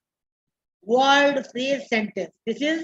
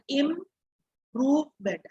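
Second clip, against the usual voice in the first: "improvement" split into slow separate syllables; 3 dB quieter, much slower than usual. Shown as a demonstration. Teacher showing students how to read "improvement", slowly by syllables. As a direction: break "improvement" into im-prove-ment, slow and even. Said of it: "read in this manner".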